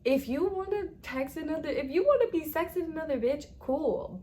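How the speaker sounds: high, loud sarcastic rant; scornful rant. Real, not acted. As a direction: tear into him with contempt. Ranting at cheating boyfriend.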